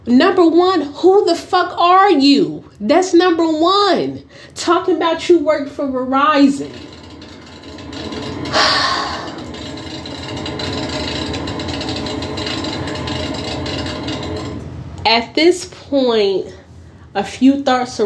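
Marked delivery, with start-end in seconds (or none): 0.1-6.7 scolding. Real, not acted. Scolding the absent saleswoman. 7.8-15.0 long sigh. Real, not acted. Long exasperated pause.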